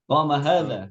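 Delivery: "Qama hadza" falling on hadza; statement tune. Shown as a demonstration. Teacher re-models falling "Qama hadza" for Mas Imam. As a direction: finish "Qama hadza" firmly downward.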